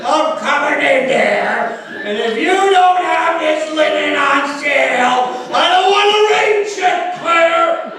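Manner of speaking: high, yelling crank caller, slow at first; crazy Joe. Acted. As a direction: play a crazy loud customer ranting on the phone. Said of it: "this crazy Joe, like".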